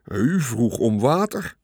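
slow, sheepish excuse; understated crestfallen reply. A narrator's reading. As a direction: answer slowly, mildly dismayed. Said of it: "zei Gijs beteuterd".

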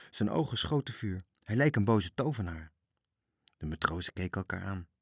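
ordinary delivery

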